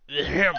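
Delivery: loud theatrical ahem; showy, exaggerated ahem; over-the-top throat clearing. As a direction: clear your throat pompously. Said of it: "most over-the-top clearing of the throat".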